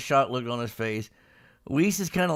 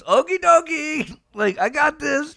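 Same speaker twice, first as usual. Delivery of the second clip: mock YouTube guy, much higher and louder; goofy high voice; 13 semitones higher, 5 dB louder than usual. Guy with the camera answering Gohan, in a goofy high voice. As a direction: squeak it out like an eager camera guy.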